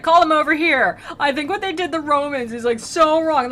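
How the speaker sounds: high, loud, half-laughing outrage; hammed-up offended protest; mock indignant fanboy. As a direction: complain shrilly through amusement. [name] protesting about Romans, laughing.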